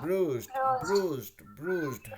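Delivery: Bruised drawn out slowly, no rush; slow, unhurried. Shown as a demonstration. Teacher shows students there is no need to rush, slow and calm. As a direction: take bruised slowly, no hurry at all. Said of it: "हड़बड़ी भी नहीं है".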